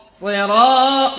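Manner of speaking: সিরা with the "raa" pulled out too far; overlong stretch. Shown as a demonstration. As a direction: show the mistake by dragging সিরা. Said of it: "লম্বা টান হয়".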